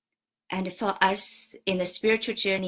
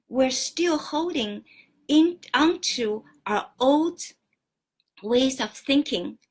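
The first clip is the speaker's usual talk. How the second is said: higher and louder, pressing the point; rising fervor; 4 semitones higher, 5 dB louder than usual. Real, not acted. Teacher urging the gathering to drop old ways, fervent and loud. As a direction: push it out with passion, higher and louder. Preacher urging listeners to let go.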